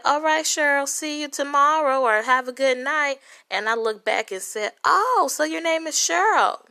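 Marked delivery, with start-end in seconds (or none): 0.0-3.2 sweet grandma. Retold or imitated. Little old grandma quoted. 4.8-6.6 sassy callout. Retold or imitated. Confronting the cashier.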